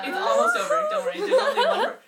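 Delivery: laughing reassurance to her friend. Real, not acted. Reassuring her friend the story ends soon, laughing.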